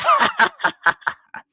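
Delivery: very high, loud, ringing laugh; a brilliant, long joyous laugh; hearty peal of laughter; splendid laugh. A narrator's reading. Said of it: "it was a splendid laugh".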